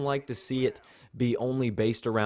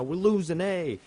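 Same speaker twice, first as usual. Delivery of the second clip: [name] impression; 8 semitones higher than usual. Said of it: "I can't do the accent very well".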